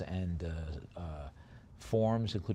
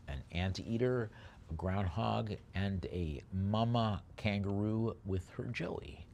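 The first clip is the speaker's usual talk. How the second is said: usual speaking voice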